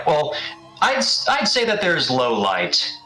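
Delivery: ordinary delivery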